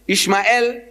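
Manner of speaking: "Ishmael" said as three syllables, ish-ma-EL; "Ishmael" pronounced ish-ma-el, Hebrew style; Hebrew pronunciation. Shown as a demonstration. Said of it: "that's how they say it".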